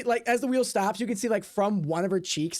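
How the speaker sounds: ordinary talk, nothing marked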